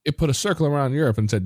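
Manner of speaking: ordinary delivery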